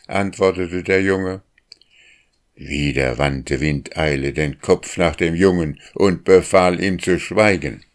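ordinary delivery, nothing marked

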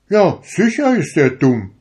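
high, loud pompous turkey; high, loud turkey agreeing smugly; puffed-up turkey. A narrator's reading. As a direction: boom it like a self-important turkey cock. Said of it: "sagte der kalekutische Hahn".

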